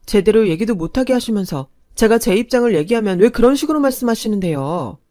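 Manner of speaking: a hint of pushing back; demanding protest. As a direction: argue back, heated. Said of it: "따지자".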